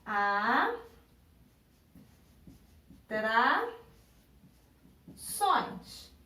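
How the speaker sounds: "atrações" very slowly, a long pause after each syllable; split into syllables. Shown as a demonstration. Teacher dividing the word on the board, "atrações" syllable by syllable. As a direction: break "atrações" into widely spaced syllables. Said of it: "vou dividir aqui".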